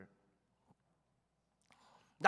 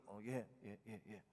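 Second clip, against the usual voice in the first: meek yes yes; 9 semitones lower, 9 dB quieter than usual. Retold or imitated. Kids meekly agreeing.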